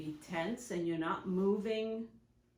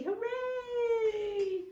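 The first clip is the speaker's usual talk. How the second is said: much higher, bright cheering cry; cheerful hooray. Shown as a demonstration. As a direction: celebrate like a kid. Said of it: "I love to use the word hooray".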